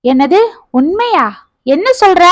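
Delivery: puzzled questions, pitch climbing; taken aback; startled disbelief. A narrator's reading. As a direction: ask in mild astonishment. Husband startled by promised confession.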